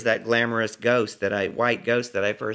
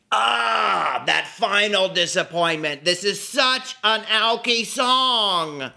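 put-on high, loud drunken outburst; theatrical wail; 9 semitones higher, 4 dB louder, slower than usual. Parker seizes on the final disappointment, wailing with delight. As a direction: cry out in drawn-out mock agony. Friend wails over the song's line.

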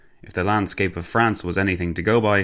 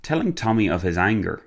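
ordinary delivery, nothing marked

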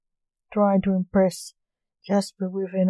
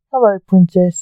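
loud, bold hello; cheerful loud greeting; 11 dB louder than usual. A narrator's reading. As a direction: greet her boldly and loudly. Prince greets princess.

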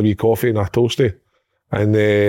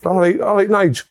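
high cockney greeting, hammed up; mock cockney; 6 semitones higher, slower than usual. Imitating a cockney player greeting Nige in cockney. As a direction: greet him like a cockney lad. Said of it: "It's a cockney, isn't it?".

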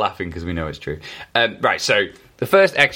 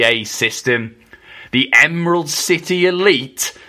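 wrestling announcer; 3 semitones higher than usual. Acted. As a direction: call the entrance like a ring announcer. Reads wrestling entrance hyped.

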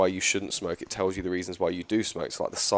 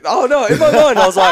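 high, loud laughing through the words; cracking up at his own slip; 14 dB louder than usual. Real, not acted. Laughing to the co-host after saying something awkward.

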